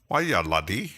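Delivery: amateur Scottish lilt, rising at the end; lightly attempted Scottish brogue; attempted Scottish accent. Shown as a demonstration. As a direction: have a rough go at a Scottish accent. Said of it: "I'd like to imitate the Scottish accent".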